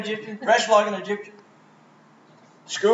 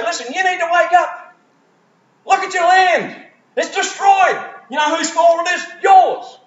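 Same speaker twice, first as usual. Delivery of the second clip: much higher, louder shouted rebuke; furious governors; 12 semitones higher, 5 dB louder than usual. Retold or imitated. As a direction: yell the blame at the king. Governors berate Pharaoh.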